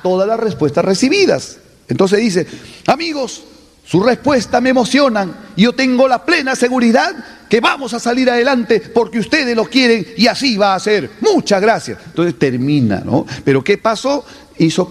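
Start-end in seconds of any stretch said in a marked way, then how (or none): 2.9-12.0 rousing call and response. Acted. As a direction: whip up the crowd. Orator closing speech.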